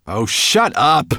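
slow, high, loud rebuke; irritated outburst. A narrator's reading. Dragon to nagging dwarf, irritated. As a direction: silence him with drawn-out annoyance.

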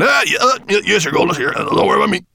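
high, jumbled, flustered stammer; frightened stammer. A narrator's reading. Dwarf answering his raging master, nervous stammer. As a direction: stammer and stumble over the words. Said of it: "stammered the dwarf".

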